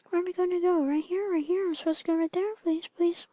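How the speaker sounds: high-pitched acted desperation; mock-frantic high pleading; pleading baby. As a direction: whine anxiously in a high baby voice. Baby pleads while being born.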